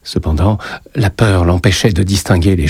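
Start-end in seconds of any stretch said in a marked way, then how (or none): none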